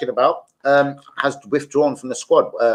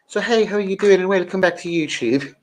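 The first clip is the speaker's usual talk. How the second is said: higher-pitched, hammed-up Irish greeting; bad Irish accent; 7 semitones higher than usual. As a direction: put on a poor, lilting Irish accent. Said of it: "My Irish accent isn't very good".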